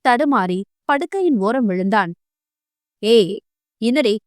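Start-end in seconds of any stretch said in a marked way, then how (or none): 3.0-4.1 scolding. A narrator's reading. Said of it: "அதட்டினான்".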